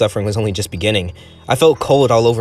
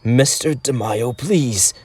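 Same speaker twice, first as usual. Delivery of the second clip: put-on pleading old man; much slower than usual. A narrator's reading. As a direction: gently beg him to stop. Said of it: "the defender pleaded".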